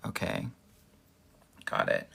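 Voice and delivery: ordinary talk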